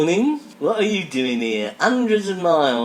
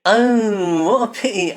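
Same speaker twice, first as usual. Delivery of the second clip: mock dismay; 7 semitones higher, 6 dB louder, slower than usual. Disappointed after hopeful question.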